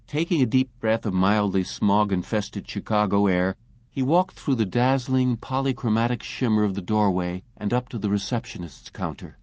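ordinary delivery

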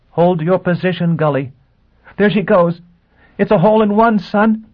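excited. Acted. Gisbella excited over radio.

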